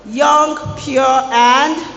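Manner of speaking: put-on loud rising call-out of "Young, pure, and"; preacher calls out "Young, pure, and" loudly, rising on "and"; loud teaching call. Shown as a demonstration. Preacher leads the congregation, loud call-out. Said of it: "what are we talking about today".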